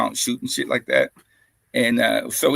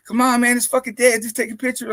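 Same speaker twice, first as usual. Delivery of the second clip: coaxing friends; 9 semitones higher than usual. Retold or imitated. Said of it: "they're like".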